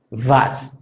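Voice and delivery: Was: w suena v, vas; w alemana labiodental. Shown as a demonstration. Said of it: "esta es la que se pronuncia así". (Was como vas)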